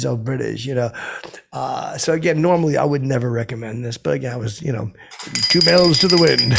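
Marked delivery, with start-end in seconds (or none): 5.5-6.5 loud exclaimed punchline. Acted. Drunk boast punchline.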